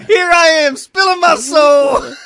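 loud, high laughing; cracking up mid-sentence; laughing hard. Real, not acted. Laughing at the coughing fit.

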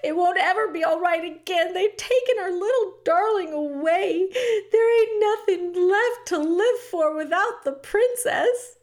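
high, loud tearful wail. A narrator's reading. Cook grieving to Louise for the lost princess, loud wailing. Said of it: "began to cry in earnest wailing and sobbing".

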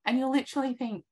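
ordinary delivery

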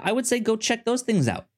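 usual speaking voice

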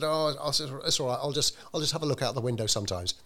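a light touch of a stubborn, cantankerous man; cantankerous Bill. Retold or imitated. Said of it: "a fairly cantankerous character".

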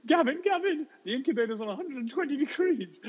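imitating a panicked little boy, very high; screaming. Little Billy at the staff room door, mock screaming. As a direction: squeal the emergency in a mock child's scream. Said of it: "little Billy is at the door screaming".

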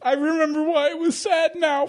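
high, crying mock lament; mock wail. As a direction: wail it like a crybaby. Crying out at the sad ending.

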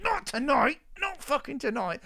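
high-pitched, indignant mock wail; mock protest. Acts out own defiant protest.

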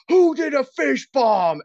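high, loud, slowed imitated yell; hammed-up furious coworker; mock angry demand. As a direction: demand the culprit like an outraged coworker. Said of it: "made, like, a scene".

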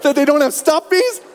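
put-on high, slow whiny little girl wail; mock toddler tantrum. Daughter wailing at dad she won't share, high and slow. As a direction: whine it like a teary three-year-old. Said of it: "welled up with tears".

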